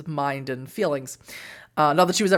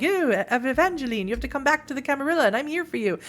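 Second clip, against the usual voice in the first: higher, hurried, put-on sweetness with an edge; mock passive-aggressive; 8 semitones higher, faster than usual. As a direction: imitate a meddling villain feigning concern. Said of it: "she's super pass ag".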